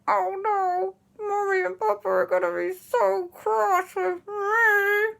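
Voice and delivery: high, slow, strained wail; frozen whimper. Acted. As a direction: fret through a mouth that won't move. Frozen aunt frets in story.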